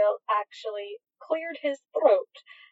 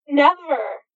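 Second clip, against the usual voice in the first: louder fervent vow, pitched up; 4 semitones higher, 6 dB louder than usual. A narrator's reading. Crowley promising never to leave, fervent and loud. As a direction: swear it with your whole heart. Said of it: "Crowley agreed fervently".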